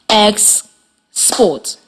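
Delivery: "Export" as two detached syllables, evenly spaced; split into syllables. Shown as a demonstration. Teacher has students listen, "Export" said syllable by syllable. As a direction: pause clearly between the two syllables of "Export". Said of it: "while I clap the syllables".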